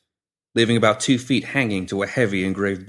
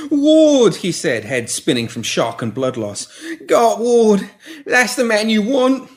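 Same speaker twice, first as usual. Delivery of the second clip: much higher, louder frantic begging; panicked pleading; 13 semitones higher, 5 dB louder, slower than usual. Acted. Pinky pleading with the woman holding shears, panicked. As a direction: beg for your life, backing away.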